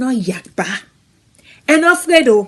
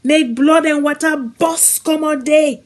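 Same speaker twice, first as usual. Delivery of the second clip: normal talking voice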